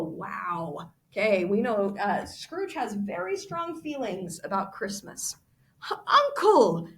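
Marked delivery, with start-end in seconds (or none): 5.9-6.9 high, loud pleading "Uncle". Acted. Nephew pleading with his angry uncle, high plea. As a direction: beg him, reaching up in pitch. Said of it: "pleaded the nephew".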